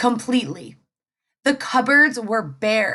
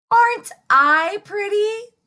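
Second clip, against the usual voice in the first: put-on much higher, preening little girl; vain girl voice; 10 semitones higher, 4 dB louder than usual. Little Red admiring herself in puddle, high preening. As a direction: admire yourself out loud.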